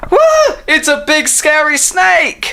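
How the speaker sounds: high, drawn-out mock fright; acted alarm. Friends spot a big snake, slow high alarm. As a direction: cry out high in pretend fear.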